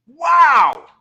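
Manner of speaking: huge, high-pitched burst of amazement; loud, soaring exclamation; amazed shout. Real, not acted. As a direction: belt it out, astonished. Amazed by correct answer.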